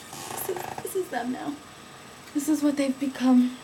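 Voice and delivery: soft, high, mock-tragic voice; mock mournful. Real, not acted. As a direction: mourn the jeans dramatically.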